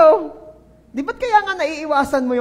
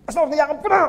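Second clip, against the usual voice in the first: mock congregant; 5 semitones higher, 5 dB louder, faster than usual. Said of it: "Hindi yung yakap na".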